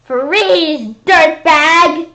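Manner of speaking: cop holler. Acted. Police ordering the monster to freeze, loud holler. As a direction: bark it like a TV cop. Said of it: "they hollered".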